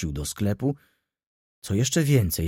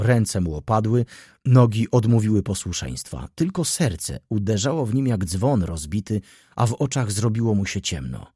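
plain reading voice

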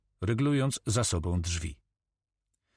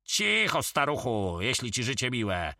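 curt snub, starting high; irritated warning to hush; snorted threat; 4 semitones higher than usual. A narrator's reading. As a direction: snort it scornfully, pitch leaping up at the start. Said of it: "prychnął Karma".

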